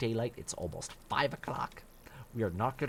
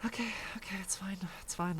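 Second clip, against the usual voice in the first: hushed okay, it's fine; whispered self-calming; 8 semitones higher than usual. Real, not acted. Streamer talking himself down after bad luck, whispering. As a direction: whisper it, reassuring yourself.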